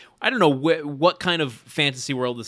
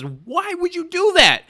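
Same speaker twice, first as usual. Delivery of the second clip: incredulous asker; 6 dB louder than usual. Retold or imitated. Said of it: "and someone says".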